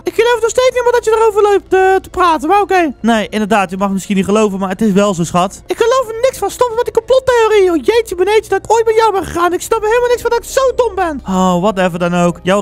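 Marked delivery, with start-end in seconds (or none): 0.0-2.9 very high put-on female voice; high-voiced, sceptical girlfriend. Girlfriend dismisses boyfriend's mirror warning, high-voiced and annoyed. 5.7-11.2 exasperated falsetto female voice; high-voiced, fed-up girlfriend. Girlfriend rejects boyfriend's conspiracy theory, high-voiced and exasperated.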